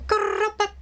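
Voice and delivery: high, soft mock turkey grubbit; squeaky attempt at a turkey sound; turkey noise attempt. Shown as a demonstration. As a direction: try out a turkey call, unsure. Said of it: "Let's see if I remember what he told me".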